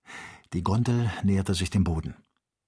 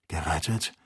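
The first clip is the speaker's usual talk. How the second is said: sad; 4 semitones lower, 4 dB quieter than usual. A narrator's reading. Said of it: "mit traurigem Lächeln".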